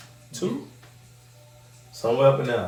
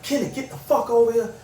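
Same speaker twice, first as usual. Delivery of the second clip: much higher mock yell; imitating Trina yelling; 10 semitones higher than usual.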